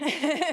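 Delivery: short amused laugh. Real, not acted. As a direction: burst into a quick laugh. Laughs about a colleague's name.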